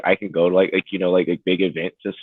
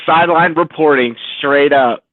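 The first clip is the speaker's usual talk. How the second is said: excited shout; 10 semitones higher, 5 dB louder, slower than usual. Real, not acted. Hyped about sideline reporting.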